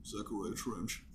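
lightly voiced creepy killer; a hint of a menacing movie villain; Buffalo Bill voice. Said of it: "Buffalo Bill voice".

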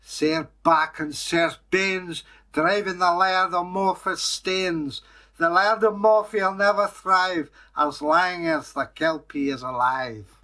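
high, forceful Scottish verse recital; recited curse poem. Acted. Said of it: "he came out with a poem".

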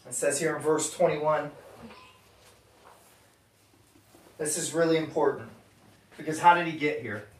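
soft, hushed altar-call voice; quiet appeal. Acted. Pastor asks the room about buried sin, hushed.

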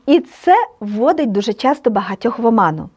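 normal talking voice

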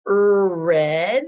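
"er, red" with the R stretched into er; wrong R sound. Shown as a demonstration. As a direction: say "er" before "red". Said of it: "do we say".

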